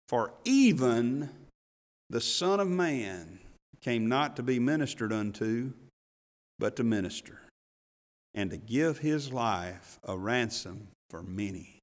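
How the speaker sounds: slow, measured reading; solemn scripture reading. Acted. Preacher reading the Son of Man verse, slow and solemn. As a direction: let each phrase land slowly.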